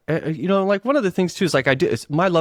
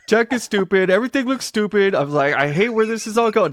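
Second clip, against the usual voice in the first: mock rant; 9 semitones higher than usual. Ranting about tech.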